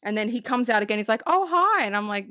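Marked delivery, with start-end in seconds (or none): 1.3-1.8 high-pitched, lightly acted hello; mock-friendly high greeting; chirpy doorman greeting. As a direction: greet as if nothing had happened. Acts doorman reappearing at knock.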